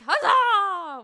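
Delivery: loud, high "Huzzah!" like a tavern toast; rowdy cheer. Shown as a demonstration. Explaining the loud kind of huzzah, a tavern-style shout. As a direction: bellow "Huzzah!" as you slam a cup. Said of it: "where you, like, break a fucking cup".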